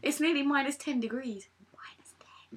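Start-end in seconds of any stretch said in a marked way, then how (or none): 1.7-2.6 whispered aside. Real, not acted. Friend whispers the temperature as a private aside. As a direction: drop to a breathy whisper for the number.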